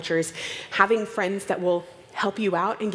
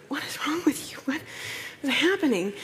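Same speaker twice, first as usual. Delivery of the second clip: higher, playfully aghast; mock disbelief; 7 semitones higher than usual. Joking about the stewardess's tea, hammed-up disbelief. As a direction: throw up your hands in fake shock.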